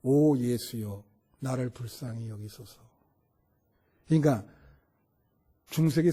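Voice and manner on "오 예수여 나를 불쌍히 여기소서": Jesus prayer. Acted. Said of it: "예수님의 기도라는 기도".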